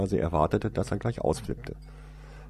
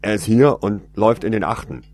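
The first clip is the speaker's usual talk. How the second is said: loud call; 3 semitones higher, 11 dB louder than usual. Acted. Man radios others.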